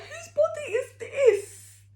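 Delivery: high, loud wailing cry mixed with laughter; half-laughing, hammed-up sob at the mirror; mock wail. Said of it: "looked in the mirror and started crying".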